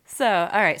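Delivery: ordinary talk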